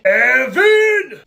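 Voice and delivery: loud, drawn-out name call; scolding yell. Acted. Parent calling Evan out over the lawsuit, scolding yell. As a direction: bellow the name in reproach.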